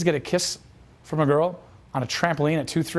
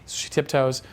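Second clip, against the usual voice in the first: usual speaking voice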